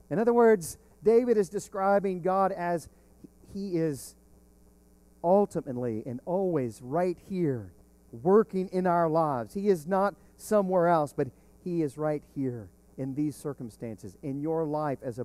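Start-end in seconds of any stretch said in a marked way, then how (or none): none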